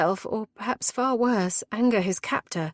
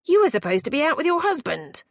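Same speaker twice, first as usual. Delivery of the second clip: angry raised voice; 9 semitones higher, 4 dB louder than usual. A narrator's reading. Intruder's angry reproach.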